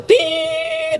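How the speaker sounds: one long high held beep, Tit. Shown as a demonstration. Preacher to congregation, mimicking a flatline long beep. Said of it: "Kalau titik itu panjang dia".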